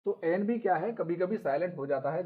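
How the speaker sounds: ordinary delivery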